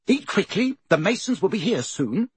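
high-pitched, impatient aunt; snapped. A narrator's reading. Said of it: "snapped Aunt Petunia".

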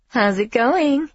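high, slow sing-song question; lilting and drawn out. Acted. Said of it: "Mindy calls in a sing-song voice".

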